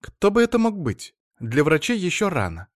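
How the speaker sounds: wife fussing over her husband, in a high chatter. Acted. Wife character voice.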